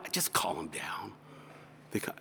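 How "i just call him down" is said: low, hushed brush-off; hushed reply. Acted. Pastor answering a question about the summons, hushed. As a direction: drop to a near whisper.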